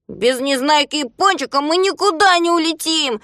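high, insistent calling voice; Знайка отвечает. A narrator's reading. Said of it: "отвечал Знайка". (высоким голосом, твёрдо)

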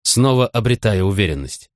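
usual speaking voice

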